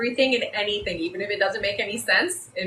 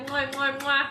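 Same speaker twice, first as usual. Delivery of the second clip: blowing kisses. Real, not acted. Signing off the live.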